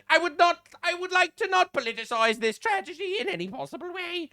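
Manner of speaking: mock the empty phrase hard; mock politician.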